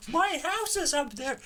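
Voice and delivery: high, crying whine of a distressed man; mock wail with a laugh underneath. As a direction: whimper it like a hammed-up sob. Repeats the line in mock crying.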